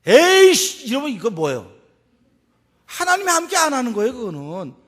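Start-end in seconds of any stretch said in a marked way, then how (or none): 0.1-1.7 hammed-up impatient outburst; acted exasperation. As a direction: blurt it like someone fed up. Acts out churchgoer losing patience.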